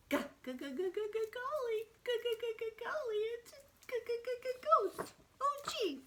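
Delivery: high-pitched stuttered "g, g, g" into "golly" and "ghost"; squeaky "g, g, g" stammer, then "Oh, gee"; repeated G sound. Shown as a demonstration. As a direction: bounce rapid hard G's before "golly" and "ghost". Teacher plays out the G sound.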